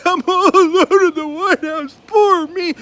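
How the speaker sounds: high, loud, drawn-out whine; whiny child mock. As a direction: ham up a self-pitying little boy. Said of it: "He's like".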